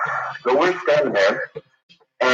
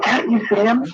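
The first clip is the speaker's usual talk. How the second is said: imitating a small girl; 11 semitones higher than usual. Caller imitating the little girl spotting David down the hall.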